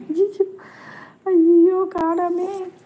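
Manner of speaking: very high, playful squeal of dismay; high mock wail. Real, not acted. Host calling for a missing sister on live, high mock wail. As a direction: wail it high, fading at the end.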